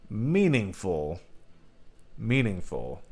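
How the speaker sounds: meaningful drawn out slow and soft, leaning on each syllable; stress on meaningful. Shown as a demonstration. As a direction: linger on meaningful, said twice. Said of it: "I emphasize the crap out of meaningful".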